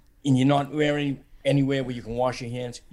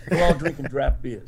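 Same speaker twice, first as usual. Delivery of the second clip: usual speaking voice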